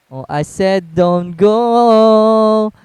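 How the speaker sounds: very slow, dragged out "go"; drawn out. Real, not acted. Repeating the warning to a teammate, dragged out very slowly. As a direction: stretch every word out.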